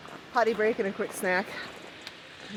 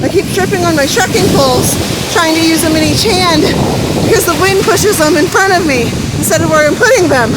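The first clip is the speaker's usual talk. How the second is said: loud, high yelling into the gale; shouting over wind; 6 semitones higher, 14 dB louder than usual. Real, not acted. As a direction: shout every word over the storm. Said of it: "I don't know if you can hear me".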